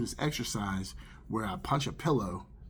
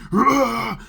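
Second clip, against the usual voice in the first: short, high, loud burst of rage; screaming roar; 11 semitones higher, 10 dB louder than usual. Retold or imitated. Man running through dream forest, screaming in rage. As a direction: imitate a raging scream at full blast.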